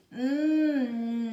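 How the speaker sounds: "Hum" as a long "nnn"; isolated N sound. Shown as a demonstration. Teacher showing the N sound, a sustained "nnn". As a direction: hold "nnn", tongue behind the front teeth. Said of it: "atrás do dente da frente".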